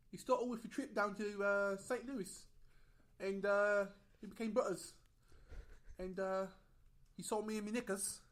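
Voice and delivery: high, loud mock British accent. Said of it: "Can you say that in a British accent?".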